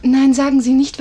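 emphatic protest. Acted. Nurse protesting to old Brookwood, loud. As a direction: cut him off loudly.

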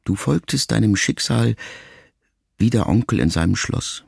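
usual speaking voice

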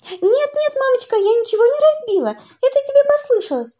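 high, fast, flustered denial; panicked girl shouting. Acted. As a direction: blurt it out quickly, panicking. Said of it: "закричала Женя". (испуганно и быстро кричит)

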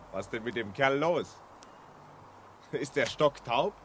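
puzzled, half to himself; muttering to himself. Acted. Journalist wonders aloud about the signalman, quietly to himself.

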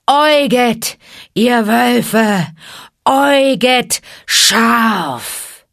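loud, high, very slow summoning call; long drawn-out call. Acted. Old wolf leader calling the pack, loud and drawn out. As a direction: call the pack from the rock, stretching every word. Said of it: "seinen lang gezogenen Ruf erschallen".

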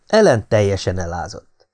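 ordinary delivery

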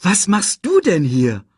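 amazed. A narrator's reading. Simba asks long-lost Nala, amazed. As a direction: ask in happy amazement.

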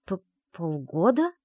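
slow, halting echo in disbelief; stammering. A narrator's reading. Secretary hearing how long it has been, stammering. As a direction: trip on the first sound, then ask. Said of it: "с запинкой переспросила я".

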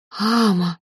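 drawn-out breath of a creature; exhaled dragon word. A narrator's reading. Mother dragon breathing hot on the fairy, exhaling. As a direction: let the word out on a long exhale. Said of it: "выдохнула она".

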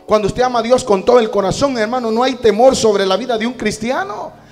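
loud, building, declamatory sermon shout; full-force preaching. Real, not acted. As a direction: proclaim it to the congregation at full volume.

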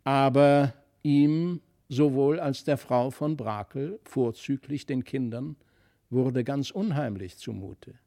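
usual speaking voice

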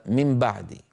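من بعد said "mim ba'd", N becoming M; nun turned to mim. Shown as a demonstration. Teacher shows what people say without thinking, nun as mim. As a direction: close the lips on an M in من before بعد. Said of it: "النون إلى ميم".

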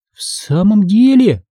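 ordinary delivery, nothing marked

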